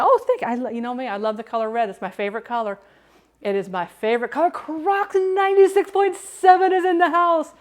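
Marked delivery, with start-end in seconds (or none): 0.0-0.5 higher, louder chirpy thank you; high mock thanks. Retelling a friend's thanks in a high mock voice. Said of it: "He goes". 4.5-7.5 high, loud, slow announcer call; announcer shout-out. Real, not acted. Streamer announcing a viewer's arrival, slow and loud.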